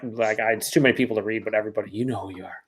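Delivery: ordinary delivery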